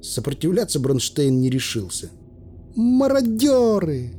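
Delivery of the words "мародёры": high, long-drawn accusing cry; woman's shout. A narrator's reading. A woman in the crowd at armed men, crying out. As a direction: call it out high and stretched. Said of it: "выкрикнул чей-то голос, вроде женский".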